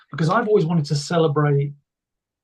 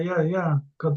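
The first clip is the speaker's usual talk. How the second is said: ordinary talk, nothing marked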